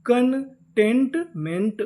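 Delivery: Containment stretched very slowly, syllable by syllable; drawn-out demo. Shown as a demonstration. Teacher slowly sounding out the whole word for students. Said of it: "पूरी meaning".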